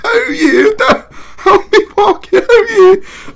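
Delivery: very high, loud, dim-witted British voice; Mick Parkin. Acted. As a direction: play the stupidest British man, squealing loudly. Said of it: "make him sound like the stupidest British man".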